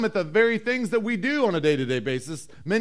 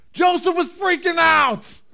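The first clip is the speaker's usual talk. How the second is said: high, loud, drawn-out outburst; shouted preacher's punchline; emphatic shout; 9 semitones higher, 7 dB louder, slower than usual. Real, not acted. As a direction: belt it out to jolt the congregation. Preacher erupts to dramatise the panic.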